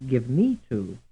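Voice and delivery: "me" stressed in "give me to"; stress on middle word. Shown as a demonstration. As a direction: lean on "me" between lighter "give" and "to". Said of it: "those that are stressed like".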